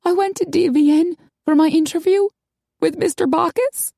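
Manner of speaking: put-on high-pitched, girlish character voice; Maggie, upset. A narrator's reading. Upset woman confessing to her friends over drinks, high character voice. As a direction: pitch it well up, wounded and confiding.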